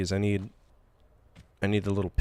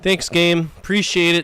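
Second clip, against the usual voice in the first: higher and louder sarcastic thanks; 8 semitones higher, 7 dB louder than usual. Real, not acted. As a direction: thank the game with biting sarcasm. Game gives no help.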